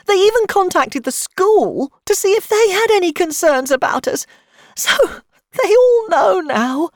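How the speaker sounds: high, loud, tearful outpouring; trembling with emotion. A narrator's reading. Mother telling how police questioned the family, trembling with emotion. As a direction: build from upset to a trembling wail. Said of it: "Her voice trembled with emotion".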